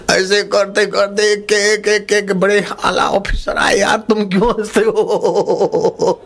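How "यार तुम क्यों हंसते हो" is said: slow, high, laughing through the words; laughing question. Acted. Senior officer asking why they laugh, slow and giggling. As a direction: scold them but can't stop giggling.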